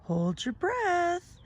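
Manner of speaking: high, slow advice from a lightly voiced Ghost; Ghost's advice. A narrator's reading. Ghost advising Skeleton, lightly voiced. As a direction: coax gently, lightly voiced. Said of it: "Ghost told Skeleton".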